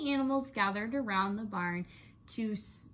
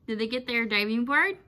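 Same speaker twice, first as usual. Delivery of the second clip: ordinary delivery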